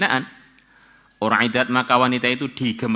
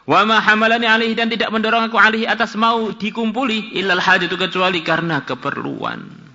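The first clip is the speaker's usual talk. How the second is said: raised, high hadith reading, loud at the start; scripture recitation; 9 semitones higher, 4 dB louder than usual. Acted. Preacher reading the woman's confession, high recitation that eases down. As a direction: launch high and forceful, easing toward the end.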